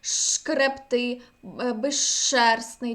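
slowly, шкребти and безшерстний with зш merged into long ш. Shown as a demonstration. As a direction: hold the hushing ш in шкребти, безшерстний. (повільно, з довгим шш: шкребти, безшерстний)

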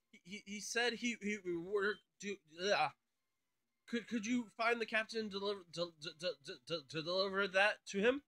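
halting, flustered character stammer; stuttering commentary. Acted. Shipbuilder asking player to find the captain, stuttering. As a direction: stumble and restart, tripping on first syllables. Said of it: "A stuttering commentary when it comes".

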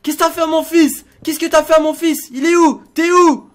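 loud, high enraged threats; furious shouting. Pretend. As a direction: roar at the kidnapper as a vengeful father. Confronts his son's kidnapper. (cris de père furieux)

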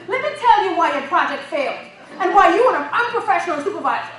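put-on loud Caribbean accent, fast and forceful; telling off the boss in a Caribbean accent. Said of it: "with my Caribbean accent".

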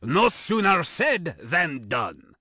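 slow, loud obliging machine voice; unhurried, pleasant automated attendant. A narrator's reading. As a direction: serve it up with drawn-out politeness. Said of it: "said the machine pleasantly".

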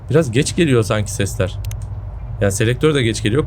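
normal talking voice

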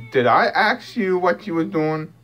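slow, drawn-out sassy question; mock offended pet talk; sassy comeback. Real, not acted. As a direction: sass the cat right back slowly. Sassing the cat back.

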